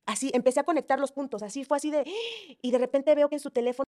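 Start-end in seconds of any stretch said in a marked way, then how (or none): 2.1-2.6 sudden high gasp of shock; sharp gasp. Retold or imitated. Caller reliving the discovery, a sharp gasp. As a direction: gasp as if catching the truth.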